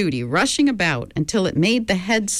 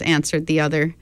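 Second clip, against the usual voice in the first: ordinary delivery, nothing marked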